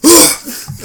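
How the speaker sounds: loud startled gasp; sharp gasp. Retold or imitated. Imitating Tim's reaction.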